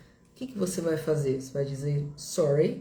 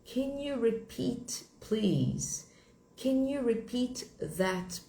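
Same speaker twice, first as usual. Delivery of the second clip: slow, higher-pitched polite English request; model request; 3 semitones higher, much slower than usual. Shown as a demonstration. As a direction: model the question slowly for learners. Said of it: "Você vai dizer assim".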